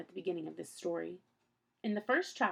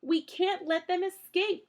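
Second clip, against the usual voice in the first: high, louder plea, a bit slow; urgent cry; 11 semitones higher, 7 dB louder, slower than usual. A narrator's reading. Klaus urging his sisters on, high loud cry. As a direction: cry out to chase them. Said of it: "Klaus cried".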